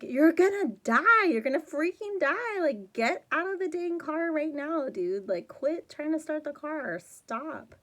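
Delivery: high, loud frustrated yell at the screen; exasperated yelling. Real, not acted. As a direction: shout at him like he can hear you, fed up. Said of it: "it really bugs me".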